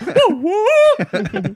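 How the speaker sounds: high, loud hoot breaking into laughter; hype noise. Real, not acted. Showing off the good noise to co-hosts, laughing high hoot. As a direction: whoop it while laughing. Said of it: "Joo, vittu hyvä melsu".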